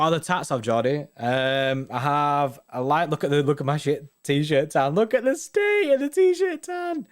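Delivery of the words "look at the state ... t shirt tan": much higher, drawn-out squeal; high-pitched exclamation. Real, not acted. Streamer showing viewers his t-shirt tan, high drawn-out squeal. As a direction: squeal it in comic disbelief, stretching it out.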